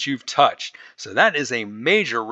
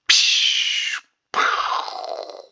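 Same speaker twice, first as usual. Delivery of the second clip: explosion sound effect. Pretend.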